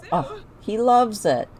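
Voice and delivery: ordinary talk, nothing marked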